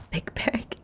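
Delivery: whispering to herself; breathy whispered echo. Real, not acted. Whispered repeat of big back.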